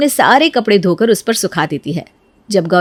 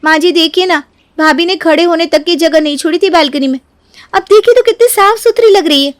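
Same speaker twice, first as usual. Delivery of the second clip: high, insistent pleading; self-justifying daughter-in-law; 7 semitones higher than usual. Acted. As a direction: plead your case to mother-in-law. Voices Neeru pleading her case. (सफ़ाई देती बहू की ऊँची आवाज़)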